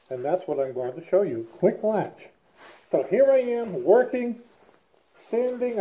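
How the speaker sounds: muffled behind face mask; speaking through half-mask respirator. Real, not acted. Seen 3: Respirator covers mouth and nose in all frames; R frames show it off.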